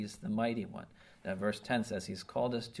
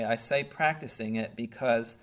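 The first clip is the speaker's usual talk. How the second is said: usual speaking voice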